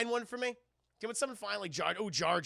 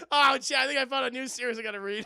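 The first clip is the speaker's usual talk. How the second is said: ordinary talk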